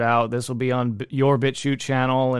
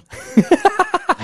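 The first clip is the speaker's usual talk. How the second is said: high, loud burst of laughing; loud laughter; 7 dB louder than usual. Real, not acted. Host amused by his own jab at the panelist, loud laughter. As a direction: burst out laughing, pitch leaping up. Laughs at unpopular opinion joke.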